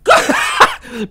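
squeaky laugh at the game; high-pitched cackle. Real, not acted. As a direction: burst out laughing.

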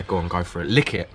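ordinary delivery

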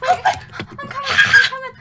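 high, loud, half-laughing call; real frantic in-game reply; urgent calling. Real, not acted. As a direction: shout back in a giggly panic. Rushing to help friend in game.

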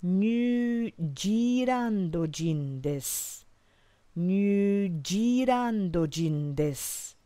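"New Zealand" said Nyu Jiilando, very slowly; drawn out with a J sound in place of the Z; slow Japanese pronunciation. Shown as a demonstration. Teacher showing the Japanese way to say New Zealand, very slow. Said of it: "you would say".

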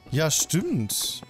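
ordinary talk, nothing marked

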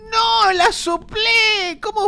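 high, loud, long drawn-out wail; wailing dismay. Real, not acted. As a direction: wail it in horror. Accidentally blew out candle. (lamento agudo y alargado)

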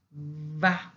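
"vaca" with a labiodental v. Shown as a demonstration. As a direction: say "vaca" with lip against teeth on the v. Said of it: "it's labiodental because it has to be pronounced like that".

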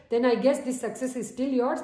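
ordinary talk

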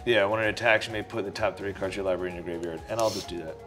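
usual speaking voice

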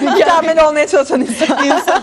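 high, laughing delivery. Real, not acted. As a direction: laugh through the line. Joking banter, laughing.

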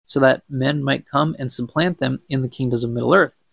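plain reading voice

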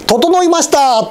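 playful high-pitched riddle call; riddle-solver's announcement. Pretend. Riddle answer announced in performer's style.

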